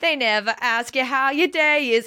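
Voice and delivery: lightly voiced musical girl; mock girl singer. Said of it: "another girl's like".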